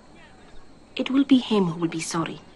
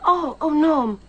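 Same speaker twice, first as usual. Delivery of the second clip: mock dismay; 9 semitones higher, 6 dB louder, slower than usual. Mock dismay at being teased.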